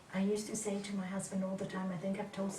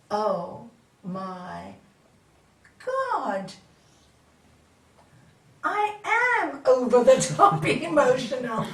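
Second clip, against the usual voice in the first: loud, laughing self-discovery; realization; 12 dB louder, slower than usual. Retold or imitated. Seeing herself in meditation, loud exclaim. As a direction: exclaim in sudden recognition. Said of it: "and I go".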